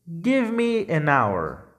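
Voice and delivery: slow, even reading of "Give me an hour" with a silent h; slow model sentence. Shown as a demonstration. Teacher models a sentence for students, slow and clear. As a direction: read it slowly so students can repeat. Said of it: "lê esses exemplos comigo".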